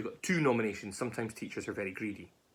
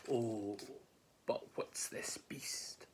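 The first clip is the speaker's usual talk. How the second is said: slow, hushed dread; awed whisper; 9 dB quieter, much slower than usual. Acted. Mouse first seeing the gruffalo, slow frightened whisper. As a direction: whisper it as the monster appears.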